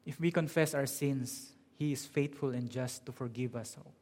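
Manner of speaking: ordinary talk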